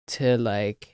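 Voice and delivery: ordinary delivery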